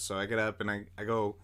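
normal talking voice

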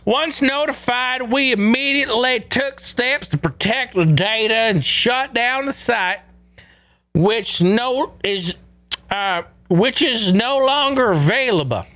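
high, slow Appalachian hillbilly drawl. Acted. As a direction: read the statement in a hillbilly accent. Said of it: "let me just get into my fucking hillbilly voice".

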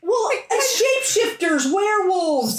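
high, drawn-out mock astonishment; mock exasperation. Real, not acted. Reader griping about the book's late twists, mock exasperation. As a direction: list them in stretched, high-pitched disbelief. Mocking a pile-up of creatures.

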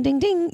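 a high, playful ding-ding like a kitchen timer; imitating timer ding. Mom mimics the timer that rules her kids, playful high ding.

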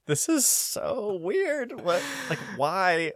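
high, drawn-out incredulous whine; retold bewilderment. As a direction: stretch it out in high disbelief. Retells own bewildered reaction.

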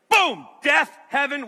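loud, shouted bursts; punchy crash narration. Retold or imitated. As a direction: slam each word like an impact. Imagines instant crash death.

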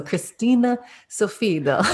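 "Sofiedal" tried the Norwegian way, as "Sofido"; a careful stab at "Christina Sofiedal" in Norwegian; attempted Norwegian name. Shown as a demonstration. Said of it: "really say her name in an authentic Norwegian accent".

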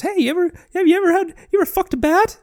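very high, fast squeaky cartoon mouse; Mickey Mouse voice. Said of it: "has, like, the Mickey voice".

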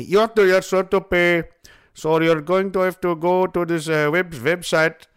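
put-on flustered Indian-accented call-center agent; Indian scam caller. Scammer telling the caller to pay up, mock Indian accent. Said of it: "This one Indian guy".

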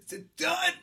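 high, whining is it done; whiny impatient voice. Retold or imitated. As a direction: whine like a bored kid. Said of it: "but I'm always like".

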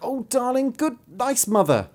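very high, sweet-talking plea; high wheedling child; begging child. A narrator's reading. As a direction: flatter mother like a begging bairn. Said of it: "the one more prettily than the other".

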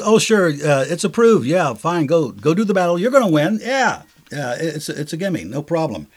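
high, loud mock agreement, easing off at the end; sarcastic. Micaiah humoring Ahab about the battle, sarcastic. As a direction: play the prophet cheering on a doomed plan. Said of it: "Micaiah comes out and in sarcasm".

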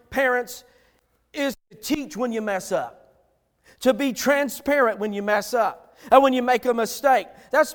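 ordinary talk, nothing marked

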